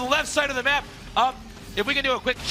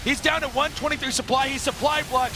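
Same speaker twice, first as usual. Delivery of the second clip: ordinary talk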